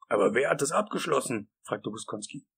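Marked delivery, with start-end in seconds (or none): 0.1-1.5 nervous character voice, pitch lifted; anxious question. Acted. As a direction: ask it with rising worry. Same whiny figure asks nervously.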